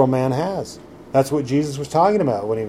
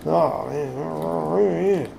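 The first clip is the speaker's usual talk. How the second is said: drawn-out, softer groan of self-pity; mock moaning; 6 dB quieter than usual. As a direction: mimic a miserable complainer. Mimicking someone miserable in tribulation.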